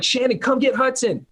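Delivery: high, urgent call for his wife; retold yell. Husband calling his wife during a live webinar, high mock yell. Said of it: "And I'm screaming".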